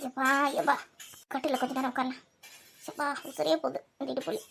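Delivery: low, very soft groaning; worn-out murmur of pain; aching and weary. Acted. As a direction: sigh out the back pain under your breath. Old woman sits with aching back.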